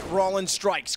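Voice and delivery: excited call. Real, not acted. Play-by-play kill call.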